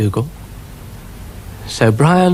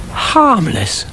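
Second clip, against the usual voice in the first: high, disbelieving question; incredulous echo; 10 semitones higher than usual. Acted. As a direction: repeat it as if you can't believe it. Dougal echoes, incredulous.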